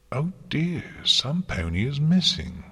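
high, bright mock concern; cheery feigned surprise in a high voice. A narrator's reading. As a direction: exclaim it brightly, pitched well up. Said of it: "Luna said brightly".

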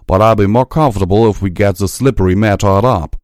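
brisk, loud country mare; Applejack voice. A narrator's reading. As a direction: push the words out fast and firm.

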